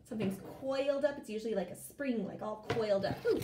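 ordinary talk, nothing marked

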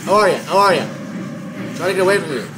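loud, high, terrified screaming; panicking gamer. Real, not acted. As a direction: shriek it while being chased. Chased by monster in game.

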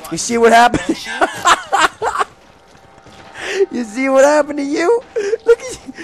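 high, loud gloating through laughter; laughing taunt. Real, not acted. Gamer taunting a dead zombie, laughing. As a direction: gloat while cracking up.

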